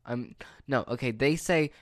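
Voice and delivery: usual speaking voice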